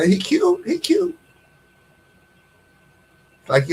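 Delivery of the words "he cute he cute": squeaky high "he cute"; high mock cute. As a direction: coo it up high, mocking. Mocking praise of a man.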